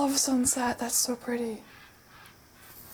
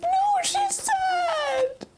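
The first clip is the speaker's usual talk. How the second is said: high slow fake sob, half laughing; mock crying; much slower than usual. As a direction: whine it like a pretend cry. Mock upset at anime scene.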